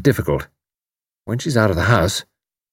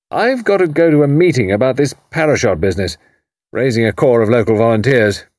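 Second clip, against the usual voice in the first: put-on bluff old commander; 6 semitones higher than usual. A narrator's reading. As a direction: boom it out like a blustering retired officer. Haydock voiced.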